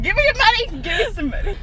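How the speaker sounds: loud playful demand; mock shout. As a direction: belt it out like a joking robber. Playful demand at drive-through.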